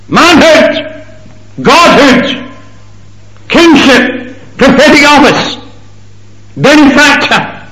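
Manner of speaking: each word cried out very high and loud; preacher crying out the list at full force; climactic cry. Acted. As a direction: hurl each title at the rafters.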